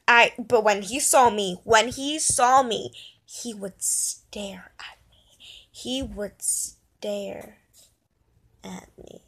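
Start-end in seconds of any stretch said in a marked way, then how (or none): 3.2-9.2 slow hushed whisper, fading out; slow creepy whisper. Real, not acted. Girl telling viewers about the staring man, slow whisper. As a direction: breathe it like a spooky secret, very slowly.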